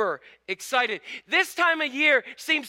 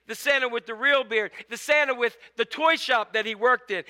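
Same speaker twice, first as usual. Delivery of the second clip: ordinary delivery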